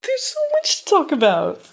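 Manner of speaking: high sarcastic gushing. Acted. Sarcastic about having nothing to say.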